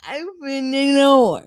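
loud, slow country drawl; country accent. Retold or imitated. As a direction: say it like a Southern girl. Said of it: "With her country accent".

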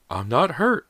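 high, loud insistent cry. A narrator's reading. Mother to her shocked family, crying out. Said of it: "Missus Fraser cried".

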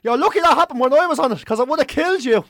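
a dad shouting to cover his own mistake; indignant dad shout. Retold or imitated. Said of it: "standing there next to him going".

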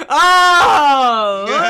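loud, high, long drawn-out "oh"; stretched-out loud reaction; mock amazement. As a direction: exclaim in exaggerated wonder.

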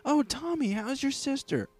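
very high, sing-out greeting; high-voiced kindergarten skit character; mock Italian greeting. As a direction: pitch it way up in pretend delight. Kindergarten skit, addressing Tommy.

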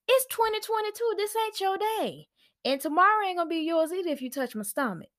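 high, fast sassy clapback; sassy comeback. Real, not acted. Snapping at pushy elders.

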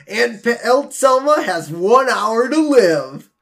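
slow, dramatic exclamation; exclaimed. Retold or imitated. Host retelling Bart popping up with his realization, dramatic exclamation. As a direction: announce it with drawn-out drama. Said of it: "pops up and exclaims".

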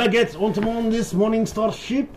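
high, hammed-up French accent; mock French accent. French sailor complaining about the ship, in a high mock French accent. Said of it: "Is that racist?".

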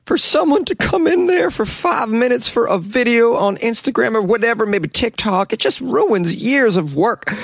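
high mock zookeeper voice, slowing at the end; quoting the zookeeper. Host reading the zookeeper's quote in a high voice. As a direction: raise the pitch to mimic the upset keeper. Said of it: "Here's a quote from the zookeeper".

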